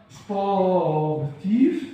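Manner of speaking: very slow "سبورتيف", each part stretched, rising at the end; drawn out. Shown as a demonstration. Teacher writing the word out for pupils, "سبورتيف" very slowly stretched. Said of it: "أكتب حروف مفرطة".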